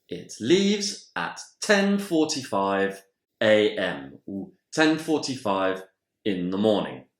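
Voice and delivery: ordinary delivery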